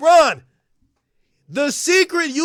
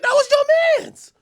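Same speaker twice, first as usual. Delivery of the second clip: mock high voice; 3 dB louder than usual.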